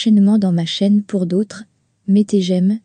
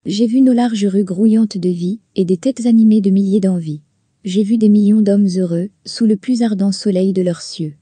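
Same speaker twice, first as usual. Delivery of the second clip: measured, earnest reading of verse; lightly declaimed poem; poem recitation. Acted. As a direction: recite each line like a heartfelt hymn.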